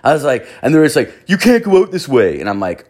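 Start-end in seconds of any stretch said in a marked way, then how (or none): 1.3-2.4 high, louder bossy imitation; imitating aggressive guard. Mocking the guard blocking their exit, high and loud. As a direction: play a power-tripping guard. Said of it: "they were aggressive".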